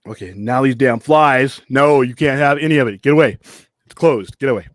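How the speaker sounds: loud, irritated scolding; annoyed shooing. Real, not acted. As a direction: shoo pests off your food, loudly. Shooing flies from his food.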